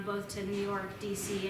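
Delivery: normal talking voice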